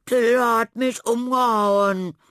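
put-on high, loud, slow childish wail; whining. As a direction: wail it like a knocked-over boy. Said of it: "jammerte er am Boden".

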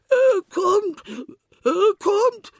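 very high, loud panicked squeal. A narrator's reading. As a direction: shriek at the knock. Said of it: "quietschte Schweinchen".

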